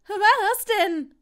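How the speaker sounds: high, very loud panicked cry; shouting back. A narrator's reading. Longbottom yelling back at Draco under spider threat, shouting. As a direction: yell the question high and hard. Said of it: "schrie Longbottom zurück".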